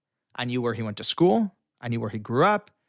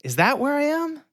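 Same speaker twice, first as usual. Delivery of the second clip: mock-innocent, pitched way up; feigned cluelessness; 12 semitones higher, slower than usual. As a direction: play dumb for the joke. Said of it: "And I go".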